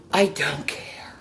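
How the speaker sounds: a breathy whispered brush-off; whispered shrug. Real, not acted. Whispering to viewers who laugh at her breathing. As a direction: whisper it like it doesn't matter. Defiant aside about breathlessness.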